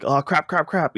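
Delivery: high yelped curses, lightly voiced; a mild mock-panicked high crap; panicked Nathan Drake. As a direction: squeak out the curses like a startled hero. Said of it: "it's the way it's pitched".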